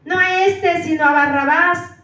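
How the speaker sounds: loud refusal from the crowd; crowd shouting. A narrator's reading. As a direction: call it out like a rejecting mob. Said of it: "dieron voces de nuevo diciendo".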